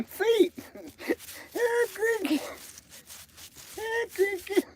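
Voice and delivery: very high sing-song pet call; calling the dog. Acted. Coaxing the dog cricket over, very high sing-song call.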